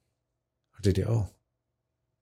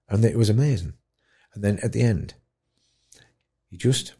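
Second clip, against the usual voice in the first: ordinary talk, nothing marked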